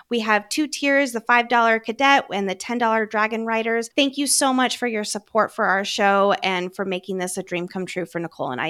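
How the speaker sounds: usual speaking voice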